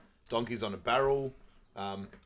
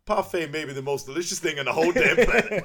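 higher, louder, laughing through Donkey's rave; 7 semitones higher, 10 dB louder than usual. Retold or imitated. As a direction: gush about dessert while cracking up. Donkey raving about parfait.

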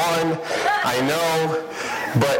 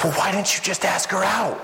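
exasperated friend; 7 semitones higher than usual. Retold or imitated. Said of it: "He goes".

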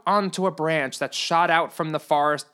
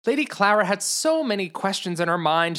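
plain reading voice

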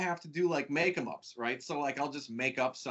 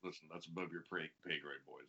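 mock boss brush-off; 9 semitones lower, 14 dB quieter than usual. Playing a dismissive boss.

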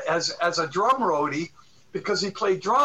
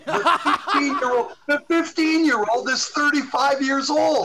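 higher and louder, laughing in disbelief; amazed disbelief; 7 semitones higher, 4 dB louder than usual. Real, not acted. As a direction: marvel at how he grew up. Amazed at grown-up friend.

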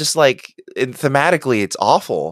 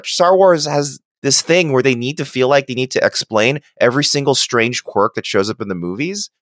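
normal talking voice

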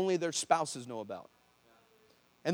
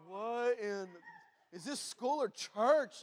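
bewildered mock disbelief; freaking out; 3 semitones higher, 4 dB quieter, much slower than usual. Classmates watch healings at school, acted bewildered freak-out. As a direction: draw it out, imitating baffled onlookers. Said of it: "People are freaking out like".

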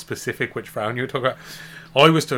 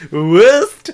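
high, loud imitation German accent; mock German accent; 8 semitones higher, 10 dB louder than usual. Teasing the co-host's attempt to sound German, mock German accent. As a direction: exaggerate the word as if German. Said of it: "trying to sound like German".

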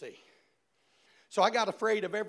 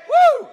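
high, loud joyful whoop; exultant woo; 21 dB louder than usual. Real, not acted. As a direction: whoop with joy. Preacher's exultant cry.

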